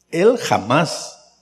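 normal talking voice

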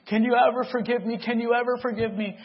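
high, pleading cry; father pleading with his son, high and loud; begging forgiveness. Retold or imitated. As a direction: beg for forgiveness.

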